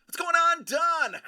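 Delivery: shouted high hello across the room; loud greeting. Real, not acted. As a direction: call out big and bright. Loud greeting call to chat.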